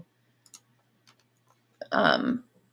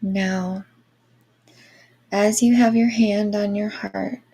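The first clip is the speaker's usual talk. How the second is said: airy breathy mystical healer. Acted. Guided Reiki meditation.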